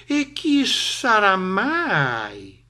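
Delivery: very drawn-out, high-pitched question; curious questioning. Acted. Snake asks who the stranger is, slowly curious. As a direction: stretch it out slowly in wonder.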